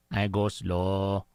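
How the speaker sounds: low, drawn-out cartoon sidekick; really slow buddy. Imitating Speedy's buddy for friends, really slow. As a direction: drag it out in a low, dopey-slow voice. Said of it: "like, really slow".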